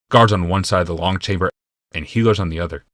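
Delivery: ordinary delivery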